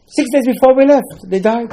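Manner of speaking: high, loud exclamation; fiery preacher's outburst; preaching climax. Real, not acted. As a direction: cry it out to shock the congregation.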